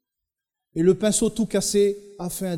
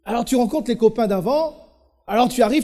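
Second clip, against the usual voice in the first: ordinary delivery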